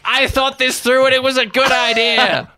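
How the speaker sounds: high, loud, stubborn insistence; cornered goblin insisting it was smart, high and loud; stubborn bravado. Acted. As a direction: defend a doomed plan. Norbert justifies himself.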